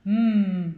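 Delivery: "M" as a long hummed sound, not the letter name; isolated sound. Shown as a demonstration. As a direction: hum a sustained "M". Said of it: "C'est le son M". (« M » tenu, lèvres fermées)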